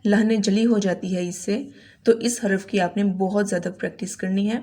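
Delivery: usual speaking voice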